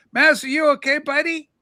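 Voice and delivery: normal talking voice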